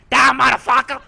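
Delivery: high, loud battle yell; full comic war shout; mock yell. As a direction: bellow it at an enemy, hammed up. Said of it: "I was yelling at him".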